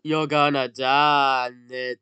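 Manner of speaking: loud, slow mock threat; drawn-out taunt. Player taunting a game enemy, loud drawn-out threat. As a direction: stretch it out loud as a playful threat.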